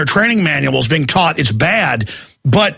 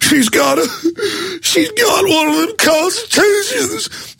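high, slow, whimpering panic; mock terrified cop; 12 semitones higher, slower than usual. Cop panics at finding a Constitution in the car. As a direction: whine it out like the Constitution is a weapon. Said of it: "they're like".

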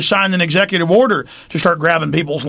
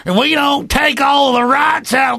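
high, slow hillbilly holler; hammed-up hillbilly shouting, words stretched out; 8 semitones higher, slower than usual. Hillbilly warning that Al Qaeda will get the rights, hollering. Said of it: "I have to get the mindset".

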